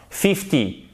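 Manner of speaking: "Fifty" with FIF strong and a short falling end; stress on first syllable. Shown as a demonstration. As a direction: hit FIF in "Fifty", let the end drop away. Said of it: "elle est sur la première syllabe". (accent sur la première syllabe de « Fifty »)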